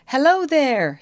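loud, high call across water. A narrator's reading. Molly hailing the youth across the river, loud call. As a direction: hail a stranger from the shore. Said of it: "she called".

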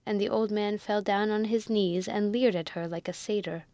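usual speaking voice

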